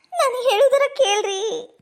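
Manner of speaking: high, slow wailing plea; tearful pleading. Acted. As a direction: wail it out, begging to be heard. Wife begs to be heard. (ಗೋಗರೆಯುತ್ತಾ ಅಳುದನಿಯಲ್ಲಿ)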